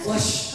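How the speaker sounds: breathy whispered flush sound; just breathe out flush; a whispered toilet flush. Shown as a demonstration. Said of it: "I was gonna say".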